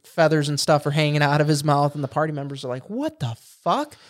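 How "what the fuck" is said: slow, high-pitched stunned reaction; appalled disbelief. Retold or imitated. Party watches goblin smash a raw quail, appalled disbelief. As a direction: draw it out in mock horror.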